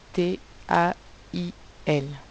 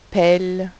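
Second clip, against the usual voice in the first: "pelle" said louder, ending in a clear L; answer word stressed; 8 dB louder than usual. Shown as a demonstration. Teacher stressing the odd word "pelle" for pupils. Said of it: "On dit pas paye, on dit pelle".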